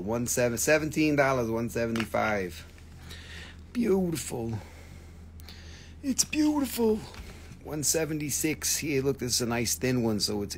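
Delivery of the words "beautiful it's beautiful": squeaky admiring voice. Real, not acted. Admiring a stone.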